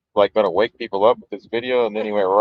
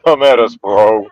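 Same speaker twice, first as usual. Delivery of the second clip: mocking bro voice; 3 semitones lower, 5 dB louder, slower than usual. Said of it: "That doesn't even sound like him".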